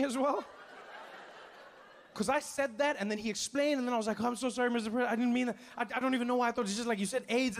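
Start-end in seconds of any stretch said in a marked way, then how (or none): 4.2-7.6 frantic apology. Retold or imitated.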